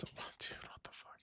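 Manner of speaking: breathy whisper, no voice at all; whispered aside. Real, not acted. Streamer whispering an aside to chat about Ray, hushed.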